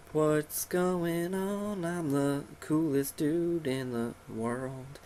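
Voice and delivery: very slow, high, soft cartoon voice; drawn-out, put-on show-off voice; cocky Pokemon. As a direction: stretch every word like a smug little creature. Voicing his cocky Pokemon.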